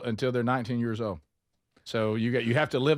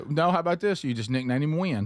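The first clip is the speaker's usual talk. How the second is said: normal talking voice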